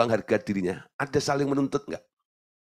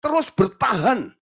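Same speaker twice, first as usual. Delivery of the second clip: preach; 10 semitones higher, 3 dB louder, slower than usual. Acted. Preaching emphatically.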